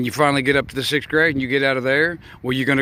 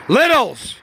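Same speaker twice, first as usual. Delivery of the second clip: calling out; 10 semitones higher, 4 dB louder than usual. Real, not acted. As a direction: sing out to gather everyone. Calling the kids in.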